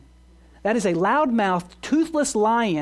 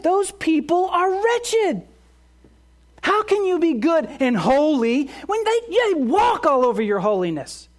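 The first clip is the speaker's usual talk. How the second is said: accusing Satan hammering each charge; accuser's voice; 7 semitones higher than usual. Acted. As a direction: rail against the sinners like a prosecutor. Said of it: "Does that sound like accusation?".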